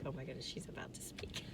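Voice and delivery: whispering like an expectant audience; hushed anticipation. Retold or imitated. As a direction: imitate onlookers whispering to each other. Audience hushed before her speech.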